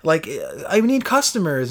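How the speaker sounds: high, eager coaxing voice; needy bar owner. Retold or imitated. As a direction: hook a passer-by with pushy charm. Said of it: "he was just like".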